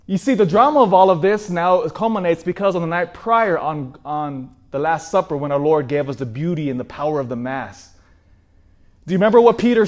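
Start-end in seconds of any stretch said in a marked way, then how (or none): none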